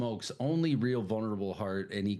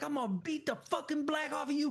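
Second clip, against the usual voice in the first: mock threat; 3 dB quieter, faster than usual. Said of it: "And he's like".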